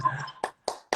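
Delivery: light burst of laughter; delighted laugh. Real, not acted. Guest reacting to the host's hail, delighted laugh. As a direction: laugh at a big welcome.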